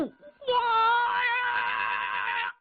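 a huge, high, ringing battle roar; storyteller's furious war cry; thunderous roar. A narrator's reading. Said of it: "他这一暴叫声如巨雷".

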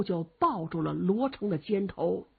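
ordinary delivery, nothing marked

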